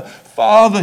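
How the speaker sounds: loud anguished cry of Father. A narrator's reading. Jesus crying to his Father in the garden, loud. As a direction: call out to him from the garden. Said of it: "he cried".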